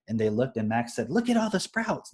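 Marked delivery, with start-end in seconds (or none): 1.1-2.1 put-on high, eager kid's voice pointing things out; bright, delighted child exclaiming; excited child. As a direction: quote Max as a thrilled little boy. Retelling Max's excited call.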